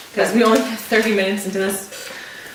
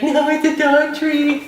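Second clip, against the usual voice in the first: high, slow teasing parent voice; mocking parents; 6 semitones higher, slower than usual. Parents mocking their daughter over the dog treat, high and slow. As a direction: play the parents laughing at the prank.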